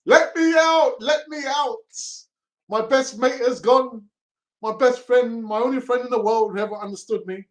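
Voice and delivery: high, loud hammed-up plea to be let out; mock desperate cry. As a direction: wail it like a trapped man begging. Voicing a player begging to leave.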